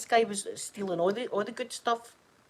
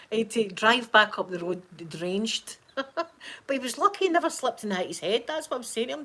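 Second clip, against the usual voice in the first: ordinary talk, nothing marked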